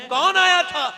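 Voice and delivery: high-pitched, eager question. Acted. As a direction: call the question out high, well above normal talk.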